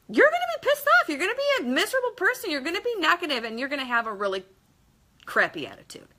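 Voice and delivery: a heated, exasperated outburst; worked-up rant. Acted. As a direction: blow up about being miserable. Ranting about endless frustration.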